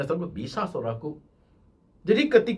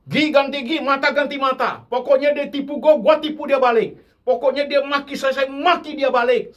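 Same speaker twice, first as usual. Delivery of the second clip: high, loud, indignant tit-for-tat rant; louder and higher, acting the vengeful worldly man; defiant worldly boast; 10 semitones higher, 5 dB louder than usual. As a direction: throw it out like a spiteful man out for revenge. Mocking worldly tit-for-tat attitude.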